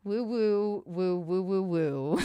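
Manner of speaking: flat mock celebration woos; sarcastic cheer. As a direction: cheer with no enthusiasm at all. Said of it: "Look how much fun I'm having aging".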